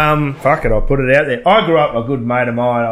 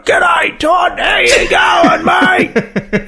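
hearty uncle voice; much slower than usual. Retold or imitated. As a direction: boom a friendly g'day down the line.

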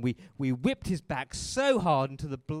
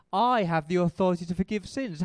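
louder and higher, announcing Jesus's claim; raised preaching voice; proclaiming loudly; 5 semitones higher, 8 dB louder than usual. Retold or imitated. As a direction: ring it out like a bold declaration. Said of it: "Here's what he said".

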